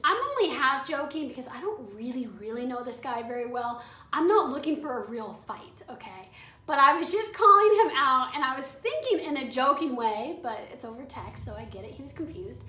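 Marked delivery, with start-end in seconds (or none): none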